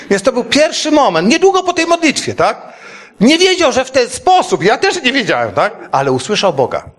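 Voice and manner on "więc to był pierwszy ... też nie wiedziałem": much higher, joyful and laughing; excited. Real, not acted. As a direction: burst out with joy. (radośnie podekscytowany)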